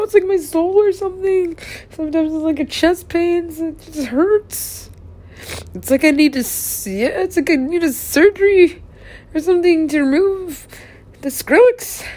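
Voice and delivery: high, strained-up plea; pained distress. Real, not acted. Speaker explaining a pain in the chest, distressed and high. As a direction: voice climbing high with the hurt. Describing pain, near tears.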